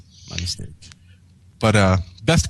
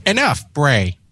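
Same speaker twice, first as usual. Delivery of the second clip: cutting off; 5 semitones higher, slower than usual. Acted. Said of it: "cut her disrespectful cousin off".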